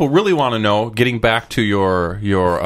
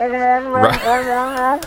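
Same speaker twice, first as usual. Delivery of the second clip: high, drawn-out robotic noise; mock Cylon sound effect; imitated Cylon noise; 12 semitones higher than usual. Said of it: "is that what they sound like".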